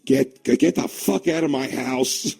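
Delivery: slow, stretched mock anger; mock angry father. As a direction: drag out the threat to the son. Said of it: "I think he'd be like".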